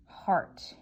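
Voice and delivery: whispered heart to herself; whispered to self. Shown as a demonstration. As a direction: breathe the word out, testing it. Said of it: "I am stuck and stumbled".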